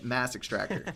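ordinary talk

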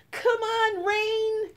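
high, loud joyful shout. Acted. Children shouting joyfully at the pouring rain. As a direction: shout up at the sky. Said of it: "we shout".

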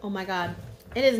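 speaking between bites; chewing while talking. Real, not acted. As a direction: mouth partly full. Seen 2: Bites puff at -3, closed-mouth chewing, then talks holding another piece.

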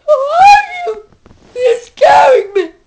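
put-on high, loud, frightened girl's shriek; girl's frightened cry. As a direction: shriek it like a scared girl. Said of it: "She just kinda looks at me".

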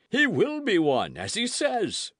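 high, hearty proclamation; exclaiming. A narrator's reading. Porthos vouches for Aramis becoming abbé, exclaiming. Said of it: "cried Porthos".

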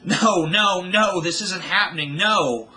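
high, loud, frantic repeated cries; grieving daughter, screaming in disbelief; screaming denial. A narrator's reading. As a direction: refuse to accept it, keep screaming. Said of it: "she screamed".

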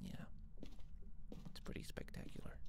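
whisper. Real, not acted.

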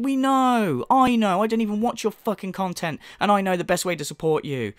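usual speaking voice

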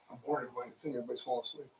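a mumbled aside that everyone is falling asleep; bored aside. Pretend. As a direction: throw it away under your breath. Mock-bored aside on air.

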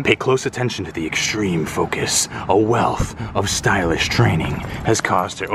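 low, measured nature-film commentary; mock documentary narrator. Commentating on a dog's focus, mock documentary narrator.

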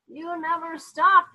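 mother yelling at the dog; mother yells, fed up, drawn out; yelled. Acted. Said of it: "yelled Mother".

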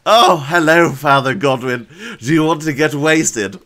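loud, grating imitation; high-pitched, booming mock voice; Beth. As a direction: put on a loud, grating caricature. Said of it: "Beth's voice, oh God".

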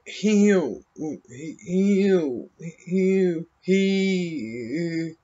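high, breathy-free mock stammer of Tom Hanks; imitating Tom Hanks. Mocking how the actor talks, high halting hews. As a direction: mimic his halting high "hew, he". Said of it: "he talks like".

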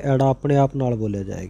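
ordinary talk

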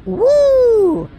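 long high, loud whoop. Real, not acted. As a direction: let out a gleeful sliding woo. Whooping while playing.

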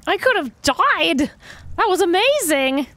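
excited. Real, not acted. Just survived a fall.

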